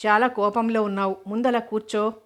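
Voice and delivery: plain reading voice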